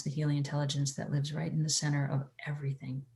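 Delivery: soft unvoiced whisper; whispered healing words. Whispered line within meditation.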